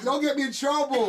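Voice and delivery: mock alarm, half laughing; teasing don't-get-me-in-trouble plea; playful protest. Real, not acted.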